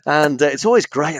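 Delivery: ordinary talk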